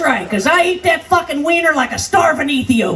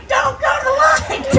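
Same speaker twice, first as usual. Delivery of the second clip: higher desperate yell; 11 semitones higher than usual. Retold or imitated. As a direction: push it up into a frantic shriek. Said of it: "I yell at it".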